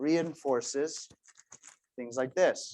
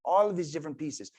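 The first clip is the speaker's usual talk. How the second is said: normal talking voice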